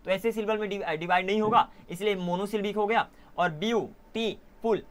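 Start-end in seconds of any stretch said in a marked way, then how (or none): 3.5-4.8 beautiful very slowly in three separate pieces, view, टी, फुल; split into syllables. Shown as a demonstration. Teacher dividing a word for the class, beautiful syllable by syllable. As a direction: break beautiful up with a pause after each syllable. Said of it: "ये तीन syllable में divide हो गया".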